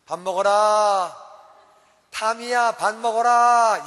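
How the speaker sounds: loud, drawn-out call to dinner; mother calling out. Retold or imitated. Mom calling child to dinner, loud and drawn out. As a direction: call the kid to dinner across the house. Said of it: "밥 먹으라고 한 번 말하지 않습니다".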